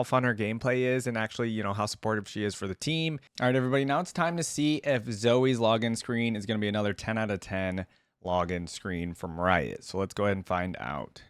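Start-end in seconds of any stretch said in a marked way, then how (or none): none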